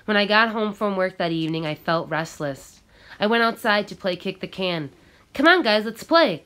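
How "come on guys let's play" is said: loud, high call to the group; yelling. Acted. Carlos yells to the kids to come play, loud. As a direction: shout across the yard. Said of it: "Carlos yelled".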